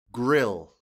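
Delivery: ordinary delivery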